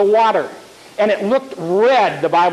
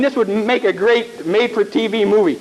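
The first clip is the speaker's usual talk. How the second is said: normal talking voice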